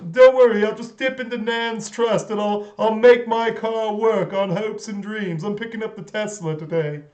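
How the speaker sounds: put-on a smug, rich Londoner showing off in a British accent; mocking posh British accent; Soft Bob posh British. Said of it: "the Soft Bob character".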